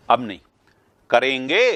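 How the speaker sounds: usual speaking voice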